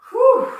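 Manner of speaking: high loud whew of relief; relieved whew. Real, not acted. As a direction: blow out hard after the set. Exhale after finishing set.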